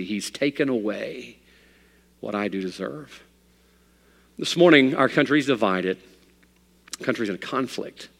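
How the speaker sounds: ordinary talk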